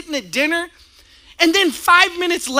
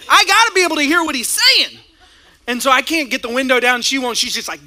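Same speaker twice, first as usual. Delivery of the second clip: ordinary talk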